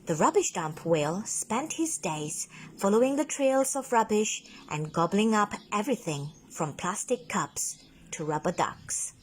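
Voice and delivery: usual speaking voice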